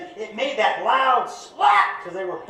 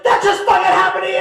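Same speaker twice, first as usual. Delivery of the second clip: fast, much higher gleeful shout; high, quick shout of disbelief; excited yell; 8 semitones higher, 3 dB louder, faster than usual. Retold or imitated. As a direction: yell it at your buddy on the floor. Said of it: "was like".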